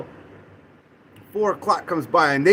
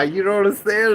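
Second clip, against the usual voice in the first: normal talking voice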